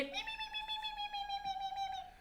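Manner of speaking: very high, thin repeated squeaks; begging penguin chick. Shown as a demonstration. Host imagines the chicks' sound on the silent camera, very high squeaks. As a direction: chirp like a chick demanding food. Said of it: "if we could hear them now".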